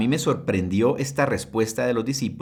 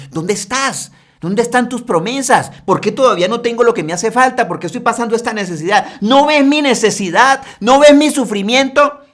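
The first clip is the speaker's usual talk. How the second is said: high, loud, fast reproach; sarcastic complaint; 11 semitones higher, 9 dB louder than usual. Acted. Believers sarcastically reproaching God, loud high complaint. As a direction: demand answers from God with sarcasm. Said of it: "igual de sarcásticos".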